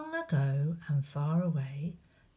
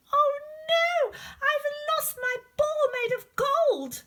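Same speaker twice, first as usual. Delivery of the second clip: high, loud, wailing princess; hammed-up wail over the lost ball; crying princess; 5 dB louder than usual. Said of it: "she began to cry".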